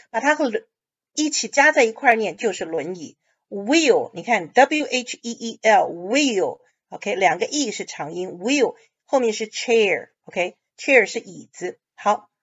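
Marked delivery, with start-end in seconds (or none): 3.5-8.8 wheel said with a long drawn E. Shown as a demonstration. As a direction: stretch the EE in "wheel" nice and long.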